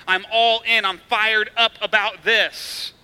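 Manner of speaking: loud, excited burst, then drawn out slowly; fired up. Retold or imitated. Preacher voicing Paul's intensity, excited and loud. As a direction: pump it up like you're all in. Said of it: "conveys a level of intensity".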